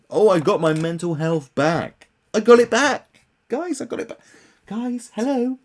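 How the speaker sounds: high, loud, gloating parody; mocking gush. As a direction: parody a celebrity's triumphant boast. Mocking the star's boasting.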